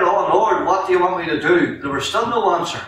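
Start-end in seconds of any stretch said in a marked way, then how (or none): 0.0-1.8 pleading prayer. Retold or imitated. Pleading prayer retold in testimony.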